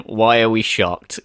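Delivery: normal talking voice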